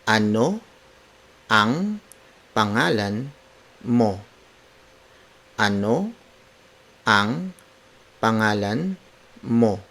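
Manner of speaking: ANO, ANG, PANGALAN, MO, each alone with a pause after; word for word. Shown as a demonstration. Teacher breaking down the name question for learners, word by word. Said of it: "Word for word".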